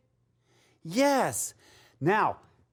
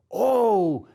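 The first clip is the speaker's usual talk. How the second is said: ordinary delivery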